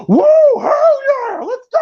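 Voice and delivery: hyped-up crowd catcall; rowdy cheering. Acted. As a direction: holler like a rowdy seventies moviegoer. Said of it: "dudes was more rowdy than we are now".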